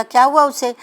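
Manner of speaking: sudden high, loud question; startled father asking what happened; alarmed question. Acted. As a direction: jump up in alarm at the news. Father asks about ill daughter.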